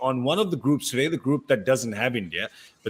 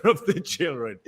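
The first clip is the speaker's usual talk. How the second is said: normal talking voice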